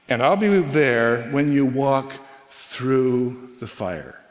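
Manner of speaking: slow, deliberate promise, fading on fire; slow, solemn reading of God's promise. Acted. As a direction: stretch each word then let fire drop away. Quoting God's promise slowly.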